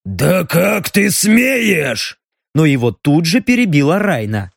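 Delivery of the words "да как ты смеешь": very slow, enraged growl; furious growl. A narrator's reading. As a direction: growl it in fury. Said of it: "Взбешённый Даррен рыкнул".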